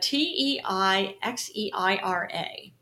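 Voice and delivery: slow, letter-by-letter spelling; measured, separate letters. Shown as a demonstration. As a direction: spell the name out one letter at a time. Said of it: "I was gonna say, I, it looks at".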